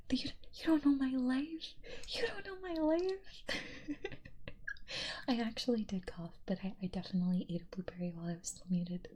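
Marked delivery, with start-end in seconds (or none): 0.1-3.4 mock offended. Mock-offended retort to chat quote. 3.5-5.2 a sudden high squeal of a laugh. Real, not acted. Laughing after mock outrage.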